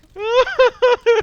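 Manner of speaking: loud high squealing laugh; shrill burst of laughter. Real, not acted. Laughing after shouting.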